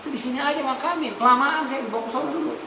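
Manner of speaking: lightly voiced righteous dead man, hurrying them; mock complaint from the coffin; a hint of an impatient corpse.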